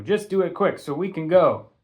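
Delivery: barked. Acted. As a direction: snap at the kids to hurry. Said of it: "Coach barked".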